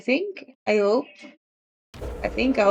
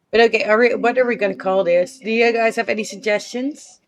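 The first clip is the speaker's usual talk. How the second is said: usual speaking voice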